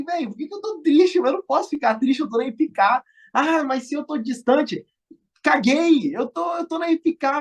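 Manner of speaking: retelling an excited pep talk to himself; excited self pep talk. Retold or imitated. Retold inner pep talk, excited.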